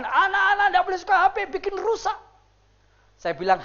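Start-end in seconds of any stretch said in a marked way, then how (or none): 0.0-2.2 high, insistent mock teacher; other teachers' warning. Other teachers warning children off phones, high-pitched mock scolding. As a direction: imitate a fussy teacher laying down rules. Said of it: "kalau guru-guru lain".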